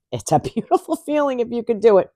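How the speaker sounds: beautiful feeling through a laugh; amused repeat. Real, not acted. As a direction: chuckle through it.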